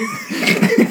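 breathy laugh at own joke; high wheezing laugh. Real, not acted.

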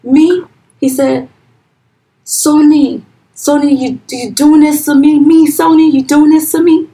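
high, loud pleading denial; imitating a pastor's outraged protest; pastor's shocked denial. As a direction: play the wounded innocent, voice cracking up high.

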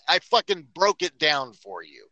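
usual speaking voice

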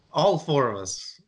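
ordinary delivery

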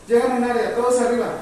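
instructor's loud, energetic push; energetic command. Shown as a demonstration. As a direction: drive the group up with energy. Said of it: "Necesito que todos rápido".

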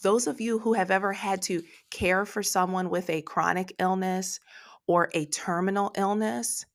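usual speaking voice

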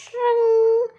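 long high drawn-out "tring"; loud bell ring. A narrator's reading. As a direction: imitate a bell ringing loudly. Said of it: "very loudly indeed".

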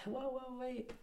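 imitating a dad's startled interruption; surprised call. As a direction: stop someone mid-step in mild surprise. Dad stopping him as he leaves.

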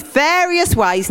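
suggestive. Shown as a demonstration. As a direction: say various with a cheeky wink.

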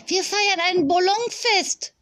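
put-on high, excited child's squeal; squealing little girl. A narrator's reading. Little girl announces a balloon party, squealing high. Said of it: "quiekte Fee".

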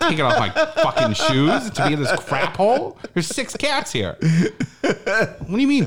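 half-laughing mock outrage, stretched out; laughing rant. Comic ranting at the host about his filthy house, laughing.

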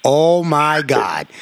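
usual speaking voice

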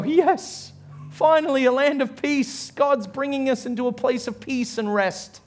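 high, elated cry of relief; triumphant relief. Acted. Diggers cheering as they finally find peace, triumphant relief. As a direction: celebrate the long-awaited breakthrough.

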